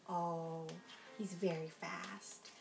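ordinary delivery, nothing marked

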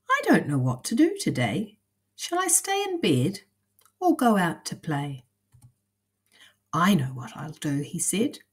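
put-on lively storybook narration for kids; reading to children. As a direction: read it like a bedtime picture book. Reads picture book to children.

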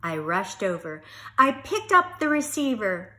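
lively, animated storybook reading; storyteller voice, loud and expressive; reading to children. Acted. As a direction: read it out big for little listeners. Reads picture book aloud to children.

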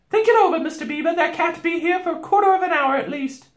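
high, loud housewife; Missus Beaver. A narrator's reading. Beaver wife calming her husband, high and brisk.

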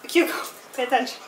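calling the dog, mock stern; talking to a dog. Real, not acted. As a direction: get the dog's attention.

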